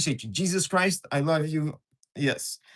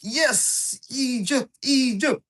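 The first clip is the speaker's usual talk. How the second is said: excited Egypt; 7 semitones higher, much slower than usual. Real, not acted. Streamer finds a mod in Egypt, building excitement. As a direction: climb with growing excitement.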